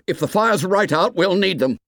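urgent high-pitched shout. A narrator's reading. Boy shouts back that the fire needs glasses, urgent shouting. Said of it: "shouted Ralph".

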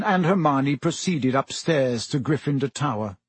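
ordinary delivery

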